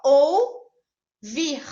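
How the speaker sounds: loud "ou", a pause, then "vir" with a clear final R; syllable by syllable. Shown as a demonstration. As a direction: break "ou vir" in two for the class. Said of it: "o R é a última letra da sílaba".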